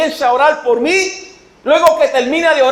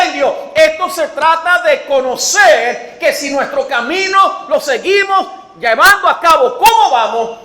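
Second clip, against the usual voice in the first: forceful preaching; 3 semitones higher than usual. Acted. Preacher warning the congregation, forceful preaching. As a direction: preach it out, pressing the warning home.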